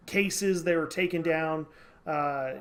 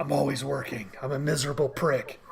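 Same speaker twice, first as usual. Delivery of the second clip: much lower and softer, mock Batman; a hammed-up brooding Batman, dropped low; 4 semitones lower, 3 dB quieter than usual. Host voices Batman turning down Superman's invitation in a low mock growl. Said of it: "And Batman's like".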